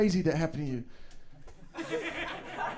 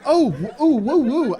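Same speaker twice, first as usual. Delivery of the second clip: loud, high woo-hoo; excited whooping; 5 semitones higher, 7 dB louder, slower than usual. Real, not acted. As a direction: cheer it on loudly. Reacting to Tom's role-play.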